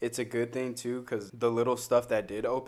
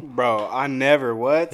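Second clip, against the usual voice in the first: loud protest; 9 dB louder, slower than usual. Real, not acted. Denying provocative bottle act.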